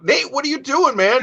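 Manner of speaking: high, puzzled call after a wandering friend; baffled call. Retold or imitated. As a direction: ask where he's off to, pitched well up. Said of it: "I'm like".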